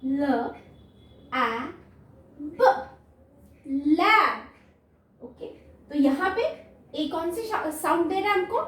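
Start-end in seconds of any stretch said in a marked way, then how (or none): 0.0-4.4 put-on teacher blending letters into a word for kids; blending lab. Shown as a demonstration. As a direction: sound out the letters, then blend them into "लैब". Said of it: "next".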